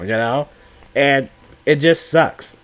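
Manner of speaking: normal talking voice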